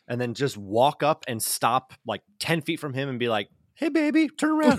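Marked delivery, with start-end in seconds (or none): 3.7-4.8 high put-on woman's voice; sweet high lady voice. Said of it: "do my lady voice".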